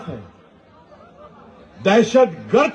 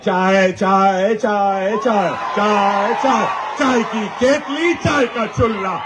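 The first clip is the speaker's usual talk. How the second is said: low, drawn-out vendor's chant; mock tea-seller cry; 3 semitones lower than usual. Speaker mocking a former tea seller, low hawker's chant. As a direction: stretch it out like hawking tea.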